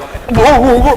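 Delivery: sudden loud alarm; panicked whoa. Retold or imitated. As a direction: cry out as the goggles fly off. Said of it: "And I'm like".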